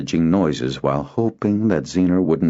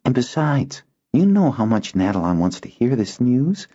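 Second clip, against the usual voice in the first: lower tone; 8 semitones higher than usual. Acted. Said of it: "he added in a lower tone".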